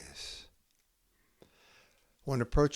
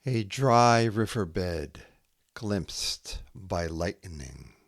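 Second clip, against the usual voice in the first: slow haiku reading with long pauses; poem reading; 7 semitones higher, slower than usual. Acted. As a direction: let each image hang in the silence.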